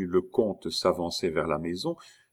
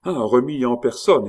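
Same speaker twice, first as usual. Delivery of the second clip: loud, raised exclamation of recognition; delighted greeting; 4 semitones higher, 7 dB louder than usual. Acted. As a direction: greet an unexpected friend with gusto.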